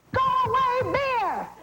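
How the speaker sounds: high shout to scare a bear; scaring off a bear. Shown as a demonstration. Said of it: "you get up and you say".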